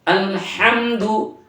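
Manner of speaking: আলহামদু said plain, no throat catch on the h; slow plain আলহামদু, h kept light; plain alhamdu. Shown as a demonstration. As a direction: clean open আলহামদু with no choked throat. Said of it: "প্লেন হবে".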